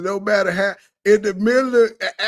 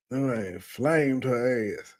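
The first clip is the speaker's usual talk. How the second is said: much lower, slow and softer; mock low drawl; 9 semitones lower, 4 dB quieter, slower than usual. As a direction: drag it out low like a deflated echo. Mock deep slow clip echo.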